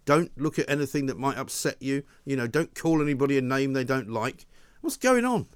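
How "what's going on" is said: exasperated. Real, not acted. Host exasperated by nanny-state rules.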